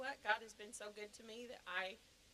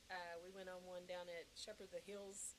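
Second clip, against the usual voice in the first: ordinary talk, nothing marked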